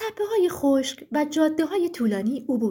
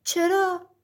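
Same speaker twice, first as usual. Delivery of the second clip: put-on a boy's puzzled question, slightly raised; surprised; 3 semitones higher, much slower than usual. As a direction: ask in mild astonishment. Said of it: "پسر متعجب پرسید". (با تعجب)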